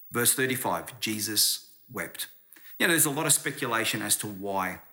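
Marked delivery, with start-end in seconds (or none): 0.1-2.3 slow, weighty, each word set apart; slow solemn reading. Shown as a demonstration. Preacher to the congregation, reading the shortest verse slowly and solemnly. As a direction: let the verse land slowly and gravely. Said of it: "Are you ready?".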